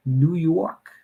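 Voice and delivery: high, questioning attempt at a New York accent. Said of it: "I'm butchering your, your accent".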